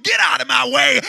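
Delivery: loud, ringing sermon climax; preaching at full force. Acted. Preacher shouting to the congregation at full force.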